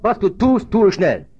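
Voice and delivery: high, forceful preacher's quote; commanding proclamation. A narrator's reading. Preacher quotes Jesus giving Judas the order, commanding.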